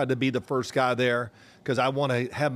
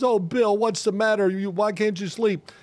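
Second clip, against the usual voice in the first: wife's voice; 6 semitones higher than usual. Retold or imitated. Wife voiced in story.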